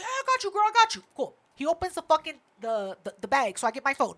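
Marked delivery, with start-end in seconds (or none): 0.0-1.0 high-pitched, eager-to-help reply; imitated obliging guy, voice lifted high. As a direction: play the guy happily agreeing, pitched up. Acting out obliging security guy.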